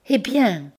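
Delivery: high, breathless anxious eh bien; anxious question. Acted. Bertrande, anguished, begs Pierre to go on, high and tense. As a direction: pale with dread, push for the answer. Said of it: "pâle et haletante d'angoisse".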